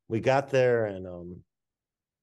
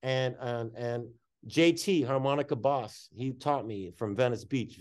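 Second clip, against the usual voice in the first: normal talking voice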